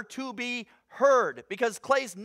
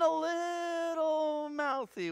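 high, long drawn-out words; teasing understatement; 7 semitones higher, 5 dB quieter, much slower than usual. Real, not acted. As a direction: stretch out LITTLE teasingly. Teasing understatement about Job.

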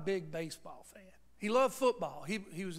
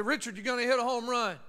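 a louder call across the ballpark; 5 semitones higher, 9 dB louder than usual. Retold or imitated. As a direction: call it out from the stands to your son. Dad calling from the stands.

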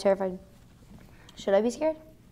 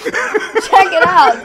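laughing; 5 semitones higher, 6 dB louder, much slower than usual. Real, not acted. Laughing ad pitch.

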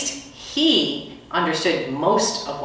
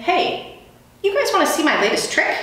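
eager custodian; 13 semitones higher than usual. Acted. Custodian showing off a trick.

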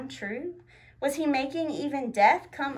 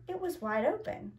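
ordinary delivery

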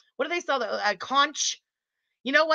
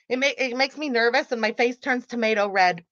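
ordinary talk

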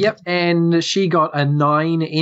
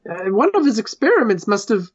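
high, halting worried voice; a nervous villager stammering out bad news; fretful little NPC; 7 semitones higher than usual. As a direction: stammer it up high like a frightened small creature.

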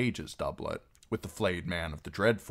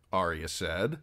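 plain reading voice

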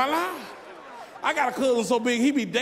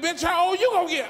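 put-on high, louder shout back at an old lady; mock yelled comeback; 8 semitones higher, 3 dB louder than usual. Church lady insulted him, he shouts back. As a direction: snap it back at her, loud.

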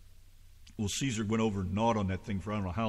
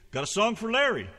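ordinary talk

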